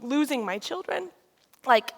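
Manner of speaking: ordinary delivery, nothing marked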